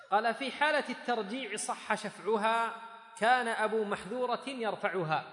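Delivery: loud, high, slow reading of a text. A narrator's reading.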